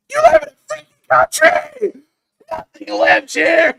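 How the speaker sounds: very high, very loud mock scream; screaming comedian. Comedian bit yelled at wandering Russians, screaming high. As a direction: shriek it like a hammed-up stand-up punchline. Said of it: "I still sound like the guy talking about".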